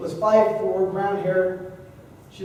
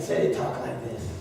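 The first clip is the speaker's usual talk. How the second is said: much lower and softer, near a whisper; menacing low cowboy murmur; a mean streak in a low, hushed voice; 13 semitones lower, 5 dB quieter than usual. Shown as a demonstration. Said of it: "I changed my voice just a little bit".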